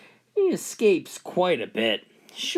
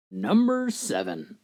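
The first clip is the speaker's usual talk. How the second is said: ordinary delivery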